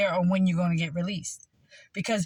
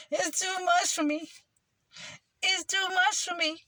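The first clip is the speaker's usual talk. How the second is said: much higher, put-on plaintive voice; 10 semitones higher than usual. Sufferer saying it is too much, in a high mock voice. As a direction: pitch it way up, hammed-up.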